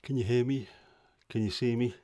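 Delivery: breathless after rushing; winded, catching breath. Real, not acted. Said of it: "Can you tell I'm out of breath?".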